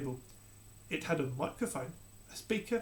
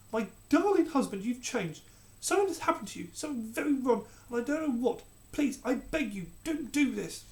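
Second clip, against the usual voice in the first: begging; 8 semitones higher than usual. Acted. Queen begging her husband.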